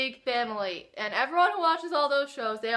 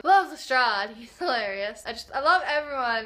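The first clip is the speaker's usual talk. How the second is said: ordinary talk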